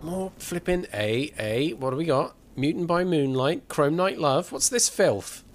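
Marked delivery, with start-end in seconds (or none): 4.5-5.4 mock disgust. Real, not acted. Reacting to trashy book titles.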